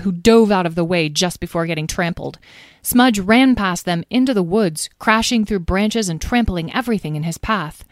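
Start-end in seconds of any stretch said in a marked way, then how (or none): none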